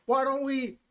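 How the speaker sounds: usual speaking voice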